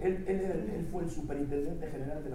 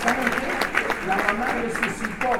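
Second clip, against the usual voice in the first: louder, punching the big reveal; 5 semitones higher, 9 dB louder than usual. Real, not acted. Preacher shouting to the church that the mother rose. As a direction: announce the miracle loudly.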